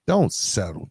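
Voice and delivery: ordinary talk, nothing marked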